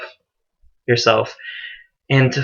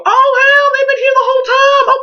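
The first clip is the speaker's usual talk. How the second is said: much higher, louder startled exclamation; mock shock; 5 dB louder than usual. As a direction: shriek like you flipped a rock and found bugs. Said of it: "look under the right rock and go".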